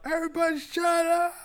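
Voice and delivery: high, shouted "shut up" like Malcolm; hammed-up yell at the whole family; mock yell. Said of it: "the Malcolm in the Middle episode".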